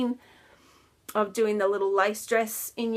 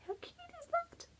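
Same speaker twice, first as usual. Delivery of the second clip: cooing; 13 dB quieter than usual. Real, not acted. Cooing over the toy's face.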